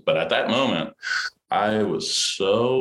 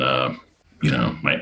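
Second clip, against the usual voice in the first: usual speaking voice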